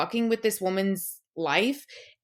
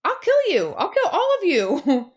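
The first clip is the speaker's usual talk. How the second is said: high, loud mock rage, slowing at the end; a hammed-up murderous snap; mock furious threat; 8 semitones higher, 5 dB louder than usual. Friend ranting about the episode, mock furious threat. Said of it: "I was like".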